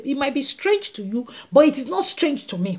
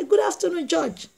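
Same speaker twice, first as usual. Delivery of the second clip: higher-pitched, softly voiced greeting; widow greeting judge; 5 semitones higher, 4 dB quieter than usual. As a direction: politely pipe up as the widow. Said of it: "She say".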